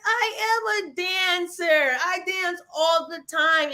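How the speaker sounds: high, ringing declaration of who you are; elated self-affirmation; joyful realization. Retold or imitated. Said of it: "And I realized".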